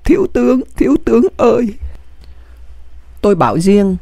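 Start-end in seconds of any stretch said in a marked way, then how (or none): 0.0-1.7 higher-pitched, contained cry of mourning; mild tearful wail. A narrator's reading. Aide keeps calling his dead general, understated tearful wail. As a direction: call out with a touch of weeping. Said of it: "Nghĩa vẫn gào khóc".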